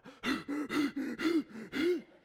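loud high frightened gasp; sharp gasp. Retold or imitated. Dad retelling his daughter's panicked gasp.

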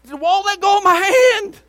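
high, very loud outburst; exasperated shout. Retold or imitated. Pastor whose hand is being squeezed, exasperated shout. Retelling the relentless hand grip.

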